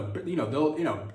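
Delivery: ordinary talk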